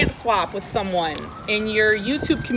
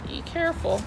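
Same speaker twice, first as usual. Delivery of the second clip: ordinary delivery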